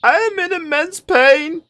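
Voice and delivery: very high, drawn-out mock wail; hammed-up cry of agony, stretched out; mock dying yell. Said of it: "if someone yells they're starting to die".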